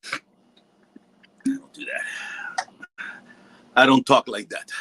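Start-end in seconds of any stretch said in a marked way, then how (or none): 0.0-3.0 slow, breathy unvoiced whisper; mock whisper. Teased about smoking, he whispers his mock denial. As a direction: whisper it like a guilty secret.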